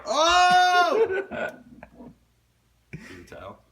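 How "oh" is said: very loud, high drawn-out howl; hammed-up wail of despair; mock despairing howl. Lost drunk wandering the streets, mock howl.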